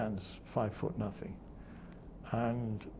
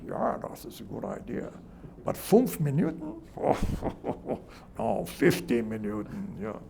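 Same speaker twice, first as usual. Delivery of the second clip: mock German accent; 3 semitones higher, 3 dB louder than usual. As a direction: playfully imitate a German TV editor. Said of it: "the Germans came over and they said".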